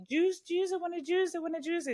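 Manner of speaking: put-on very high small-child voice, whining; squeaky kid begging for juice; toddler demanding. Mother imitating her son begging his dad for juice, high toddler whine. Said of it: "y ma".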